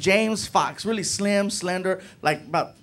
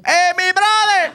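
loud greeting; 13 semitones higher, 8 dB louder, slower than usual. Retold or imitated. Juan calling on the phone.

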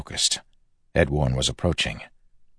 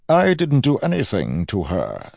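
higher, lightly voiced villain; raised denial across the room; villain's retort; 5 semitones higher than usual. A narrator's reading. Villain answers an accusation, voice raised.

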